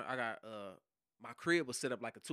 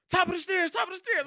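much higher, louder barked warning; retold police shout; 10 dB louder than usual. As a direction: shout it out to the team. Quotes officers shouting.

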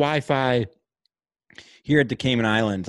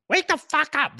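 mock baby yell; 13 semitones higher than usual. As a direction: yell it like a wailing infant. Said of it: "That's all he's saying is".

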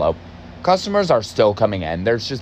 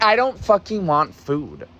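exasperated rant; 6 semitones higher than usual. Retold or imitated. Ranting back at lazy boss.

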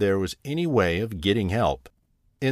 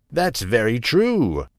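dim slow booming giant; 3 semitones higher, 4 dB louder, slower than usual. A narrator's reading. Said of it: "said the ogre".

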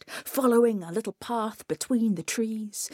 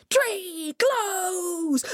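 high, sung-out command; crying out; 11 semitones higher than usual. A narrator's reading. Coyote playing with the magic tree, high crying out. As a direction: call it high and long. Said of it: "he cried".

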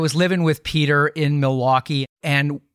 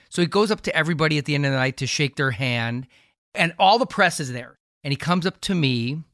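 usual speaking voice

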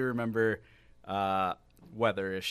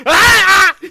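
loud, high sudden yelp; sharp yelp; 22 dB louder than usual. Acted. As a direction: let out a high shriek. Sudden yell after joke.

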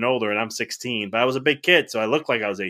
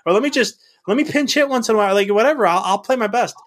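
very high, loud exasperated plea; worked-up retelling of a frustrated protest; frustrated venting; 13 semitones higher, 4 dB louder than usual. Retold or imitated. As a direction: rant and plead with the voice way up. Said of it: "I'm so frustrated about that".

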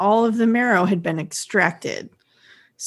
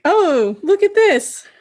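high, louder, impressed coo; pleasantly surprised; 10 semitones higher, 5 dB louder, slower than usual. Retold or imitated. Host voicing a supervisor's flattered thoughts, pleasantly surprised. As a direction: voice someone's delighted discovery, pitched up.

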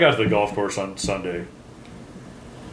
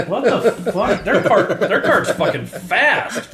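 laughing; 9 semitones higher, much slower than usual. Real, not acted. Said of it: "we're like".

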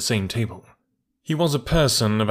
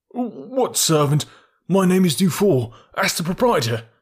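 high, rising panic, louder toward the name; nervous denial; 9 semitones higher, 3 dB louder than usual. A narrator's reading. Suspect insisting on his false name to the detective, panicky. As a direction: protest too hard, half panicked.

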